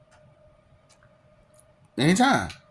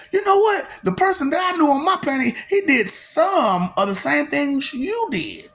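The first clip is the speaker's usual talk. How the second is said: much higher, put-on voice of a stranger; mock high voice; 8 semitones higher than usual. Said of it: "they be like".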